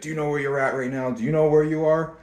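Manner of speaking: put-on voice of a man confronting someone; confrontational tout. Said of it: "he walked up to me and he grabbed my arms".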